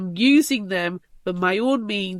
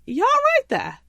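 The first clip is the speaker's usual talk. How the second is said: friends' reply; 14 semitones higher, slower than usual. Pretend. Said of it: "they're just like".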